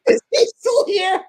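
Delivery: high, loud, slow through laughter; laughing mock Oprah. Retelling Oprah's rude question, laughing high mock voice. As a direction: laugh while mimicking the snub. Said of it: "and she goes".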